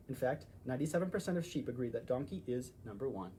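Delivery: plain reading voice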